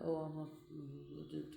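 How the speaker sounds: normal talking voice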